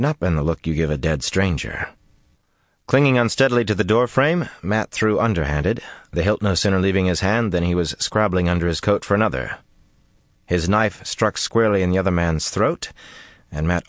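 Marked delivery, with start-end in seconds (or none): none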